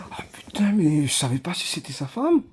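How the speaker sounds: retold onlooker's whisper; mock whisper. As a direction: breathe it like an embarrassed bystander.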